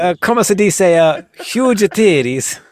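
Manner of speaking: slow, stretched mock Italian accent; hammed-up Italian accent. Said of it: "in an Italian accent".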